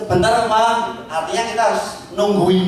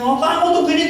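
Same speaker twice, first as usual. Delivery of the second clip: put-on high, nagging girlfriend voice; playing the cross girlfriend, pitched high; mock scolding girlfriend; 11 semitones higher than usual. Girlfriend scolds her late boyfriend, high and nagging.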